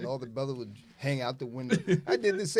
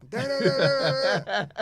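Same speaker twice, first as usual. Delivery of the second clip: mock barking; 7 semitones higher, 8 dB louder than usual. Imitating dogs barking at women.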